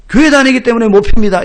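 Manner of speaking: loud, steadier repeat of the refusal; firm military reply. Retold or imitated. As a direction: say it again firmly, standing to attention.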